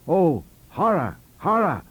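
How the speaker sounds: theatrical shudder of horror; mock horror. A narrator's reading. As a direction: let the dread spill over, hammed-up. Narrator recoils at the books.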